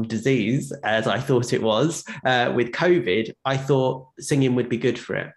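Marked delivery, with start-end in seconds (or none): none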